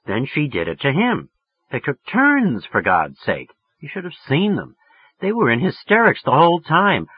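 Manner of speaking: plain reading voice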